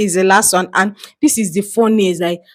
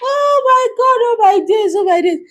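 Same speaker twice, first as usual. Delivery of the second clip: high giggly oh my God; amused disbelief; 13 semitones higher than usual. Real, not acted. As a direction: squeal it out in disbelief. Reacting in disbelief, laughing.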